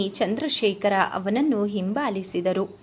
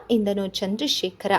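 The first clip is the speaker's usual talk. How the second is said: usual speaking voice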